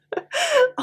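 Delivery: short high giggle; laughing. Real, not acted. Teacher giggling after voicing the fruit. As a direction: laugh at your own silly voice.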